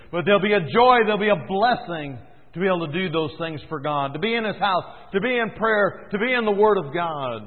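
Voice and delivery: high, loud exultant pulpit voice; preacher celebrating service to God; joyful preaching. Acted. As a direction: lift them with the joy of obedience.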